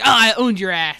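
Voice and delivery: loud triumphant crowing; Ben Shapiro victory screech. Acted. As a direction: crow it out suddenly loud, in mock triumph. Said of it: "he does a victory screech".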